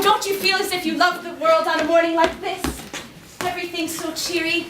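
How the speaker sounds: usual speaking voice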